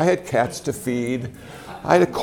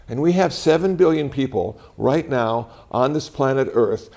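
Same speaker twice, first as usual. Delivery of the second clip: normal talking voice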